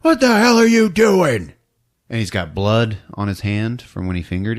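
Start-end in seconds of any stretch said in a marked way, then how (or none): 0.0-1.5 high, loud yell of alarm; parents yelling. Retold or imitated. Parents catching him in the hall, yelling. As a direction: bark it out like a shocked parent. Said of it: "her parents walk out across the hall like".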